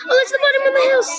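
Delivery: high, loud, quick burst of surprise; excited discovery. Real, not acted. Finds the bottom of her house.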